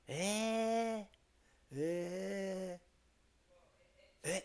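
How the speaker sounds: high mock "eh?"; mock surprise. Streamer showing chat fake surprise: high mock eh. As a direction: fake being amazed. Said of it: "Be like".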